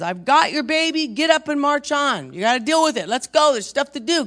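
loud, high, urgent rallying call; God's forceful command. Retold or imitated. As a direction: belt it out like marching orders. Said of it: "God says".

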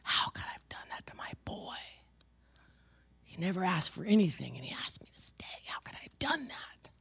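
retold grief in a broken whisper; grief-stricken. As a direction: whisper it as a mother's guilt-ridden anguish. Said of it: "I howled and cried".

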